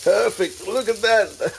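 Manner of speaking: bright, pleased high exclaim over the toast; delighted. Real, not acted. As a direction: show off the perfect flip, thrilled. Proud flip of the toast.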